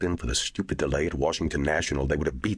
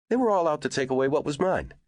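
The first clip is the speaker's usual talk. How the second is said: heated retort; 12 semitones higher than usual. A narrator's reading. Neenan defends himself heatedly.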